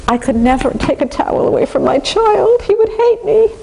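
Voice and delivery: high, fretful parent voice, rising to a squeak; permissive parent. Retold or imitated. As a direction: worry like an anxious pushover parent. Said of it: "if they're very permissive".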